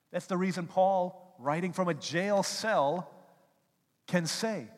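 high, loud build to the quote; preaching at full force. Acted. Preacher introducing Paul's letter, loud high preaching. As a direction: thunder it from the pulpit.

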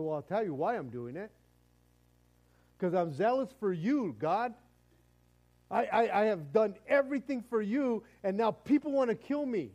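loud, high, aggrieved protest; indignant self-justification. Acted. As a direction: argue back at God, insisting on your zeal. Acts Elijah arguing back at God.

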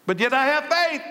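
high, lightly voiced smug believer; mock churchgoer. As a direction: parrot the easy answer back at them. Mimics a complacent churchgoer.

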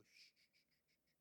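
soft, voiceless hush; quieting someone tenderly; gentle shushing. Retold or imitated. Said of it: "God's gonna go".

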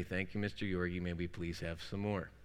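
low, soft, flat chant; monotone. Retold or imitated. Students reply to the teacher in a soft monotone. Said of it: "say this in a monotone voice".

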